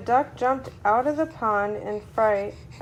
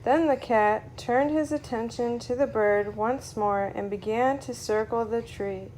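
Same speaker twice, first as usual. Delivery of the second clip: usual speaking voice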